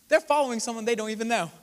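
usual speaking voice

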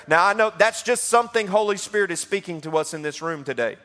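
ordinary talk

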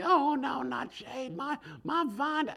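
high whining mock lament over the vine; high, far-off whine, mocking Jonah; whiny Jonah. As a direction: whimper it like a pouting child. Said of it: "gets him all upset".

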